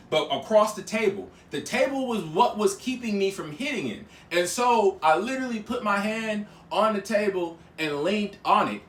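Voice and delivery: ordinary delivery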